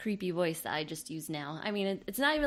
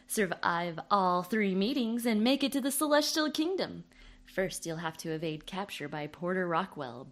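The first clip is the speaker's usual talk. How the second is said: spoof ad read; 7 dB louder than usual. Acted.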